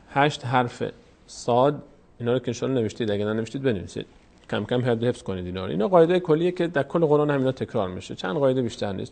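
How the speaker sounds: usual speaking voice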